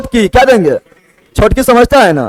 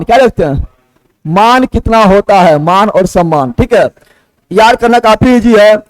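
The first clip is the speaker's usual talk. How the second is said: usual speaking voice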